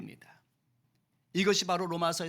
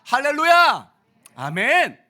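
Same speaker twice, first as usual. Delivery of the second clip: high, loud hallelujah cry; joyful shout; 8 semitones higher, 14 dB louder, slower than usual. Real, not acted. Pastor crying out praise to the congregation, loud joyful shout.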